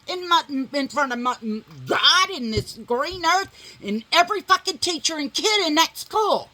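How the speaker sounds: rising outrage, letting him have it; retold furious rant.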